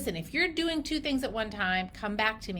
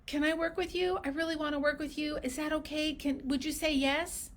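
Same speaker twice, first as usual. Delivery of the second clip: higher, softer eager plea; pleading patient; 4 semitones higher, 3 dB quieter than usual. Acted. Patient asking the clinician to take them on, pleading. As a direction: beg lightly, small and hopeful. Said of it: "the patient is the one that's saying".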